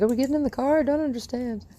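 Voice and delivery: high, puzzled voicing of the dog; dog's voice. Trainer voicing her puzzled dog at the car, high and bewildered. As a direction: squeak it like a confused puppy. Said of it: "She's like".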